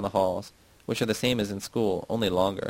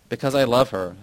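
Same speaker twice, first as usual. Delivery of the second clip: ordinary delivery, nothing marked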